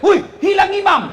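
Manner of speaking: high-pitched cry of alarm; teriak kaget. Acted. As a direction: yell it out like a startled boy. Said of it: "Langsung teriak". (berseru tinggi karena kaget)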